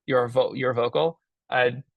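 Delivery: usual speaking voice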